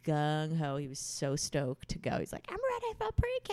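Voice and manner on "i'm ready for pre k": put-on much higher kid voice, proud and eager; a mother imitating her son on his first school day, high and proud; eager little boy. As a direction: squeak it out like a proud four-year-old. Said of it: "I can hear him say that in a Russian accent".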